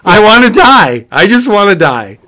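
loud, high cry of comic agony; gleeful mock despair. Real, not acted. Fan reeling from the Hammer time joke, gleeful mock despair. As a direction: blurt it out, overwhelmed by the pun. Overjoyed despair at a terrible pun.

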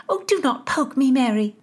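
high, irritated snap; snapping. A narrator's reading. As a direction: snap at a friend crowding you. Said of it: "Nazareth snapped".

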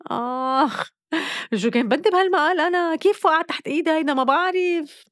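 high, fast dismayed wail; groan, then panic at what she read. Real, not acted. Shocked by the aging article. (صدمة ويأس بصوت عالٍ)

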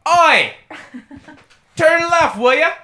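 loud, very high mock-British yell; hammed-up British. Riffing on the British GPS nagging, hammed-up British shout. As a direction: shout it like a cartoon Brit. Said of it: "Sorry again to our small contingent of listeners in the U K".

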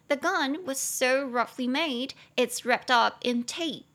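slow, careful English model sentence; slow model reading. Shown as a demonstration. Teacher reading the model sentence aloud to students, slow and clear. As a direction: read it slowly and clearly for learners. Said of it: "那而且他这个自制手枪是怎么做的呢".

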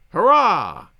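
loud toasting hurrah; cheer. Acted. Narrator toasting an old soldier, a loud hurrah. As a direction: raise a glass and cheer it out.

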